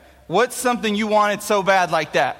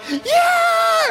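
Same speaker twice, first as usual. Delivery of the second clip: very high, loud whoop; excited shout; 4 dB louder than usual. Retold or imitated. Kids whooping as the door opens, excited shout. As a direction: whoop it and run.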